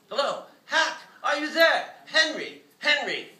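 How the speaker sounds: high, loud calling through the phone; phone shout. A narrator's reading. Caller on phone yelling for Hack, loud and high. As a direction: yell for him to pick up. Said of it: "yelled Wilcox".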